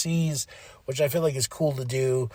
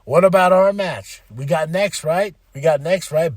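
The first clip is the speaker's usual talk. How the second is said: loud, high and eager, louder throughout; excited; 6 semitones higher, 7 dB louder than usual. Retold or imitated. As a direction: call out eager for your turn. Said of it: "They're like".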